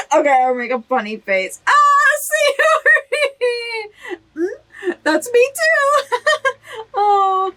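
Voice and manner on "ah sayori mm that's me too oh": high, stretched-out shriek of joy; delighted shriek. Real, not acted. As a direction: cry out her name, overjoyed. Sees Sayori's funny face.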